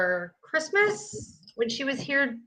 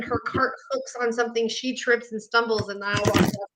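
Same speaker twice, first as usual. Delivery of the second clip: ordinary delivery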